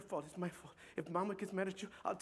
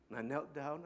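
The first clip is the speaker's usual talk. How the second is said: normal talking voice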